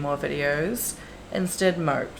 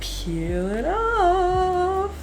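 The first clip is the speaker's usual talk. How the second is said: excited high voice; 14 semitones higher, much slower than usual. Real, not acted. Excited peeling film.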